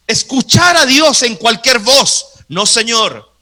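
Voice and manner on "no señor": ordinary talk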